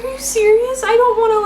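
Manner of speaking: high, stunned "are you serious?"; squeaky astonished disbelief; shocked disbelief. Retold or imitated. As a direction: gasp it out like you can't accept the gift. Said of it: "I was like".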